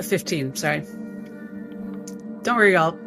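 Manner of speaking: ordinary talk, nothing marked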